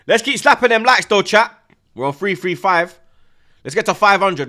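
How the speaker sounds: loud, high rallying call; hype shout. Real, not acted. Streamer rallying chat for likes, loud hype shout. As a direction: whip the chat up.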